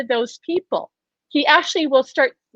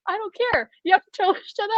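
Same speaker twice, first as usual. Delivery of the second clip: insistent; 7 semitones higher, 5 dB quieter, much faster than usual. Retold or imitated. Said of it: "He'd say".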